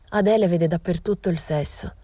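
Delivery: ordinary delivery, nothing marked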